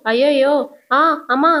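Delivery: yelp of pain. A narrator's reading. Grandmother yelps as coffee spills on her sari. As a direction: cry out as hot coffee spills on you. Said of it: "అని లేచి అరుచుకుంటూ".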